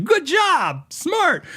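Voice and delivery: very high-pitched mock enthusiasm; high, hammed-up congratulation; fake customer praise. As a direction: gush phony praise at a customer. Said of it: "You're supposed to say, like".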